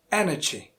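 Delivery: ENERGY with weight on EN; EN-ergy, falling after the first syllable; first-syllable stress. Shown as a demonstration. Teacher repeating the correct word, stressed on EN. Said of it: "stress falls on the first syllable".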